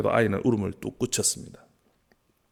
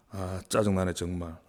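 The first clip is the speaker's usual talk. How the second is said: usual speaking voice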